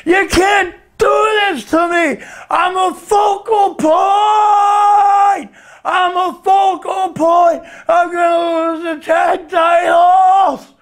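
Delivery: high, slow, drawn-out mock howl; hammed-up anguished protest, vowels stretched; drunken wrestler wailing. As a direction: bellow it in the strained big-guy impression voice. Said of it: "apparently I'm going".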